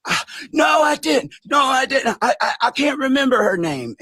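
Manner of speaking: hammed-up flustered excuse, half-shouted; mocking Larry. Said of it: "my accent isn't that great".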